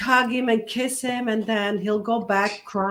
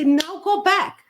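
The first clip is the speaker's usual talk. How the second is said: higher, louder blunt order; firm command; 9 semitones higher, 4 dB louder, slower than usual. Retold or imitated. Father orders his son back onto the field, firm and higher. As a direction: send him straight back out. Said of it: "You gotta say".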